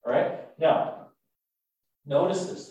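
ordinary talk, nothing marked

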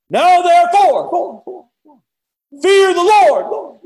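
very high, drawn-out booming shout; echoing valley proclamation. Acted. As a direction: bellow it slowly across a whole valley. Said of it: "I can just hear it echo in the valley".

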